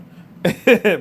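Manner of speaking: quick loud laugh; a sudden bright laugh; short burst of laughter. Real, not acted. Laughs at broken bits.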